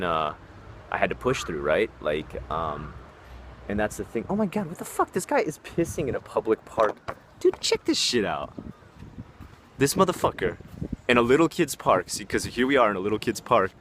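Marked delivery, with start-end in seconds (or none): 4.3-12.1 high, startled disbelief at what he sees; shocked outburst. Real, not acted. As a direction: caught off guard, calling it out. Spots man urinating in park.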